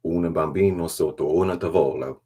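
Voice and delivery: loud mock-Italian delivery; badly faked Italian, projected; Italian accent. Shown as a demonstration. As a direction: put on a hammed-up Italian accent. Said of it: "Oh, my Italian accent".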